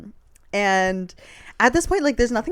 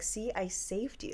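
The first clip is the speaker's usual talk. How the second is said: mock smug saviour; 11 dB quieter, slower than usual. Voicing a manipulative partner.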